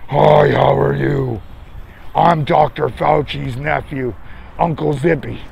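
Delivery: menacing greeting. Shown as a demonstration. Streamer showing how he greets people in his mask, low menacing hi. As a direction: drop the voice to spook them. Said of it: "That's how I approach you".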